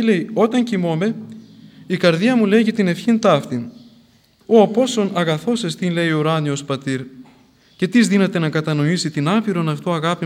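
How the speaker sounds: fervent sermon delivery of the saint's words; preaching. Acted. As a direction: preach it with fire.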